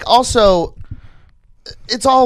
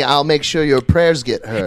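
mock preacher; 8 semitones lower, slower than usual. Mocking greedy church voice.

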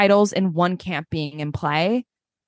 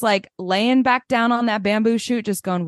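ordinary talk, nothing marked

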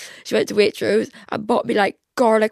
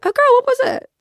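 mock excited squeal; high gossipy girl voice; 6 dB louder, faster than usual. Asking a friend on the phone for the tea, squealing. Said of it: "I was like".

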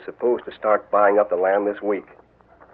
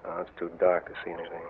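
much softer, brushing it off; hushed dismissal; 10 dB quieter, faster than usual. Acted. Blackjack to nervous Harvey, hushed dismissal. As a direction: wave it away under your breath. Sneaking in darkness.

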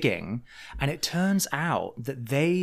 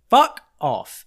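blunt retort; 4 semitones higher, 9 dB louder than usual. Retold or imitated. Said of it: "And I'm like".

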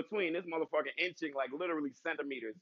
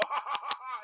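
mock cabbie laugh; 8 semitones higher than usual. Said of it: "Or they go".

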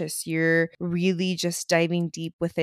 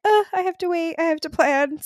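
much higher, put-on whiny voice; mock whiny inner voice; 11 semitones higher, 3 dB louder than usual. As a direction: playfully whine about having to wait. Mock inner voice about money.

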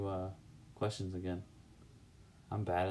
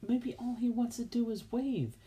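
high mocking voice. Teasing husband about neighbor.

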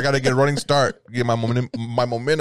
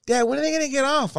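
put-on high-pitched child's whine; voicing his daughter, much higher; imitated daughter; 13 semitones higher, faster than usual. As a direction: pitch up high like an impatient kid. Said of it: "my daughter's like".

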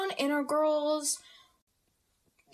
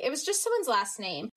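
normal talking voice

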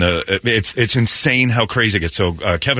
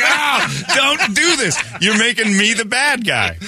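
high laughing protest; laughing plea; 11 semitones higher than usual. Retold or imitated. Host retells pleading with a stranger, laughing. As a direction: beg him to stop through laughter. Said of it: "I'm like".

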